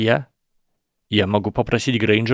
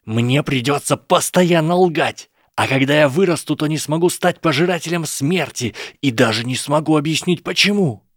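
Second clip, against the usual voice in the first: anguished outburst; 6 semitones higher than usual. A narrator's reading. Draco's anguished outburst at Harry.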